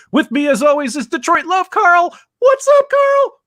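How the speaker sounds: rising, loud host hype; hype intro. Real, not acted. Host hypes up his co-host Carl, big excited intro. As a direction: announce him like a wrestler.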